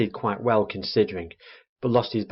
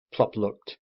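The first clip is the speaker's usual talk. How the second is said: ordinary delivery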